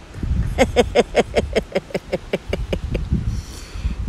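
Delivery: long, soft voiceless titter; chattering giggle. A narrator's reading. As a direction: let out a drawn-out, evenly pulsed giggle. Playful noise after the story's climax.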